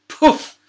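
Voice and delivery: loud, high puff of air; a sharp burst of escaping air; puff sound effect. A narrator's reading. Ball is popped, loud high puff.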